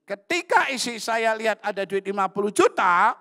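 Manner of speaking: high, very loud preaching cry; climactic shout. Retold or imitated. Preacher telling the congregation what his wife saw, high shout. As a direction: shout it out as the story's big shock.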